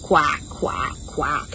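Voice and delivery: low, short quacks; duck quack. Shown as a demonstration. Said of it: "What does a duck say?".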